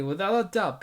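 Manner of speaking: ordinary delivery, nothing marked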